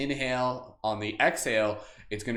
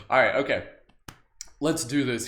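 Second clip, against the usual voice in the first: ordinary talk, nothing marked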